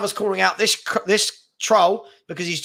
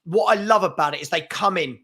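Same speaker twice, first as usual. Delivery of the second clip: ordinary talk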